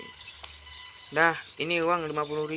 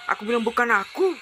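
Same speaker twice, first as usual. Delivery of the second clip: acted angry shout, pitched up; angry husband; 5 semitones higher than usual. Husband denying taking the savings, angry. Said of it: "Kok kamu malah marah?".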